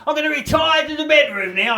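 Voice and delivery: high prissy imitation of the missus; mock the wife's lofty announcement; mimicking the wife. Comic mimicking his wife announcing bedtime, high mock voice. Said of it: "She goes".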